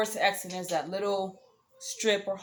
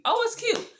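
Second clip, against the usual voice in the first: gushing; 4 dB louder than usual. Shown as a demonstration. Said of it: "If I see Balenciaga shoe".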